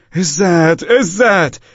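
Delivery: high, loud call for help from far below; calling from a pit. A narrator's reading. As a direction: call out a name as if from the bottom of a well.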